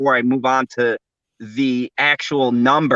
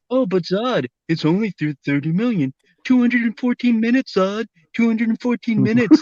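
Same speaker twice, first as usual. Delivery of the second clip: whiny mocking fanboy voice; Snyder fan voice; 7 semitones higher than usual. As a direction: mimic a pushy fanboy. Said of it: "that's the Snyder voice".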